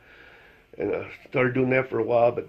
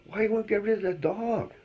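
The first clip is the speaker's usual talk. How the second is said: higher-pitched, baffled voice; higher, amazed voice to the dog's owner; amazed question; 10 semitones higher than usual. Retold or imitated. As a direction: ask it like you can't believe it. Asking the owner, joke buildup.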